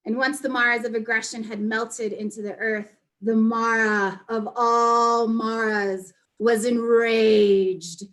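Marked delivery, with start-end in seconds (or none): 3.2-8.0 loud, slow, drawn-out story climax; dramatic storytelling. A narrator's reading. Storyteller announcing that the Mara of all Maras was enraged, dramatic. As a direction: stretch and swell the words for menace.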